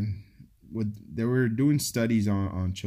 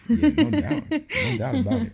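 much higher, laughing squeal of agreement; giggling yes to a friend's joke; 4 dB louder than usual. Real, not acted.

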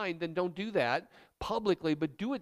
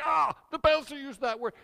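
high, louder scandalized gasp of a listener; shocked churchgoer; 7 semitones higher, 6 dB louder than usual. Acted. Pastor mimics a shocked listener, high and scandalized. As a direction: play the prim congregant, scandalized. Congregant shocked by swear word.

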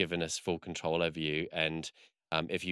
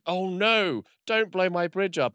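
high, loud hammed-up alarm; mock dismay; 12 semitones higher, 5 dB louder, slower than usual. Officer seeing bombs on his beloved bridge, mock dismay. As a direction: plead in exaggerated panic, retelling the scene.

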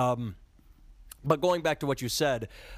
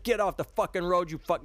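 higher, half-hearted retold yell at the deer; mock angry yell; 8 semitones higher than usual. Retelling yelling at deer crossing the road, mock angry yell. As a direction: fling it at the deer like you're back in the car. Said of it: "angry, like".

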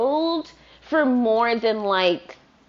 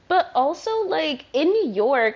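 normal talking voice

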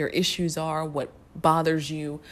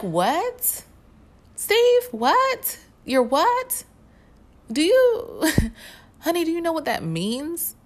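incredulous; 12 semitones higher, 5 dB louder, slower than usual. Retold or imitated. Therapist reacting to child's jargon.

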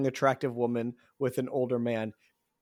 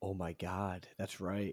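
sudden hushed whisper; 8 semitones lower, 13 dB quieter, slower than usual. Real, not acted. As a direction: drop to a breathless whisper.

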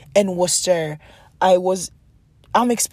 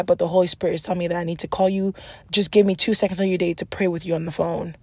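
ordinary talk, nothing marked

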